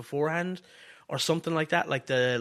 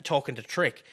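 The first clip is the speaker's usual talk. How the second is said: usual speaking voice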